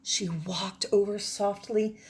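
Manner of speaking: put-on expressive read-aloud for children; story narration. Teacher reading a story to her class, expressive read-aloud. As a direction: tell it like a bedtime adventure.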